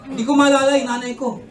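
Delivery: normal talking voice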